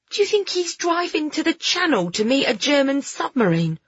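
put-on high-pitched boy's question; curious boy Alfie. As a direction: wonder aloud with eager suspicion. Story figure, curious boy.

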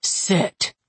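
measured voice of authority; one word, calmly commanding. A narrator's reading. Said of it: "said in a calm, measured voice laced with command".

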